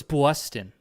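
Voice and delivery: exaggerated New York take on "Boston"; mock New York accent. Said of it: "that's New York".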